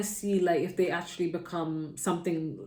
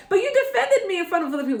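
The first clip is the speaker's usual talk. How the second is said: put-on much higher, fast kid voice, laughing through it; protesting child; 12 semitones higher, 6 dB louder, faster than usual. Child protesting to parent, high, fast and giggling. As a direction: squeak it out like an indignant little kid.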